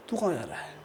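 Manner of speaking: put-on low, soft, sinister old man's question; a lightly voiced devilish old man, quietly threatening; hushed and eerie, like a spirit who has you trapped; menacing ghost voice. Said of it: "एक devil smile दी".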